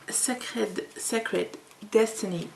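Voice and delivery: playful mock English accent on the card deck name; best English accent. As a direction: say the English title with a jokey English accent. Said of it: "mon meilleur accent".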